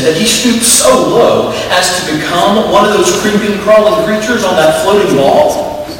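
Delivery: high, loud and scornful, "creeping, crawling creatures" sneered; a young angel sneering at the creatures of Earth; disgusted young angel. Acted. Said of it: "The little angel's face wrinkled in disgust".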